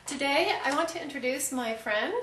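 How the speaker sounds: presenter introduction. Pretend. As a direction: announce a guest to the camera. Mock presenter intro for interview.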